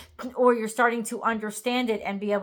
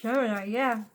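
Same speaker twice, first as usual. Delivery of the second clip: talking through a yawn; words stretched by a yawn. Real, not acted. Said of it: "I apologize for my yawning".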